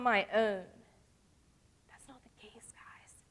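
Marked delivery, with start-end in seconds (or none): 1.9-3.2 whisper. Real, not acted. Whispered reassurance.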